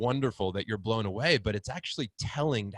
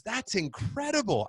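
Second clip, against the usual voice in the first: higher impressed stranger; 11 semitones higher than usual. Retold or imitated. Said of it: "He goes".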